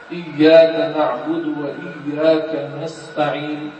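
slow, measured Quran recitation; still, unforced recitation. Shown as a demonstration. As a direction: recite calmly and slowly, without affectation. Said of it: "تقرأ وانت ساكن".

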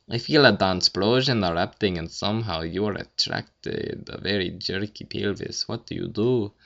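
put-on a lightly done, awful Russian accent; bad Russian accent. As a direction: fake a clumsy Russian accent. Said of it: "That's, like, Russian or something".

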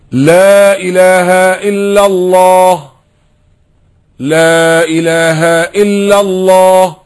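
slow, loud and high, lips kept still; loud declaration of faith without moving the lips. Shown as a demonstration. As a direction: call it out slowly, barely moving the lips. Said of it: "مع إني بقولها بصوت عالي أوي أهو".

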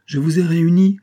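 voix plus haute et plus forte de patriarche; déclaration appuyée. A narrator's reading. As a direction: reprends la parole avec autorité, haut et fort. Le vieillard reprend son annonce solennelle. (annonce appuyée, sonore)

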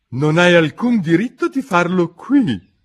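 a squashed dormouse protesting; lightly voiced dormouse. Dormouse scolding Alice for growing, mildly voiced.

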